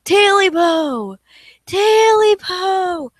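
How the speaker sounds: slow, loud ghostly shriek. Acted. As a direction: shriek it like a spirit demanding back its own. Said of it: "the voice grew louder and louder".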